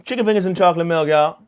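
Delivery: loud, high, playful holler; hammed-up exclamation. Comedian declaring his death row meal to the crowd, hammed-up holler. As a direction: belt it out like a proud announcement.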